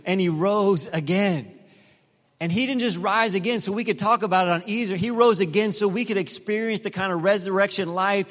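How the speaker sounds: rising Easter preaching; fervent preaching. Real, not acted. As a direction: proclaim it, pressing every step. Preaching the resurrection.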